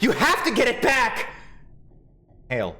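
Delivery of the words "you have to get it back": higher, louder, pressing harder; insistent urging. Acted. Urging Siffrin to reclaim home, louder and higher. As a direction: push the demand harder.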